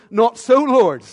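loud, sharp protest; Peter's retort. Retold or imitated. Peter protesting loudly to Jesus. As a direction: blurt it out as Peter objecting.

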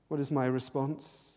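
ordinary delivery

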